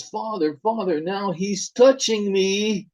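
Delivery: put-on a scared boy crying out that he is being touched; terrified child. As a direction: plead with father in terror. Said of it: "And the son says".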